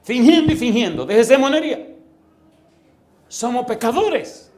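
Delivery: loud, high-pitched preaching cry; forceful rebuke. Real, not acted. Preacher rebuking the congregation for pretending, loud and forceful. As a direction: scold the crowd at full preaching force.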